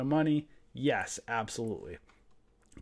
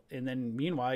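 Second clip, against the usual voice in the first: normal talking voice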